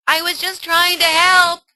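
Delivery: mother hurt as daughter walks off. Acted. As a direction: plead that you only meant well. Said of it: "mother adversary".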